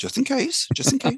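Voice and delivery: put-on a high, squeaky old Pope. Pope nervously insisting to the doctors, in a high squeak. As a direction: play the Pope as a jittery old man piping up.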